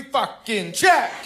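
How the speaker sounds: punchy one-word shouts with pauses; emphatic shouted checklist. Acted. Dad lists his chores to his daughter, emphatic shouting. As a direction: tick off each item with a loud burst.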